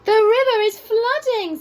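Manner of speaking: high squeaky voice raising the alarm; squeaky giraffe. A narrator's reading. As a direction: squeak out the warning like a startled giraffe. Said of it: "in a surprisingly squeaky voice".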